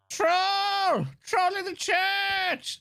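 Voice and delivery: high, slow shouted alarm; mock panicked shout. Player voicing a panicked villager, high slow shout. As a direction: cry out the warning like a scared villager, drawn out.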